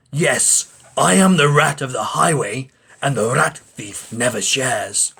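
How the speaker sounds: boastful bandit rat demanding food; sing-song swagger on the highway; hammed-up highwayman rat; greedy rat. Rat character voice in story.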